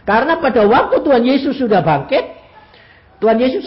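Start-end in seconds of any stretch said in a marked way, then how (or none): none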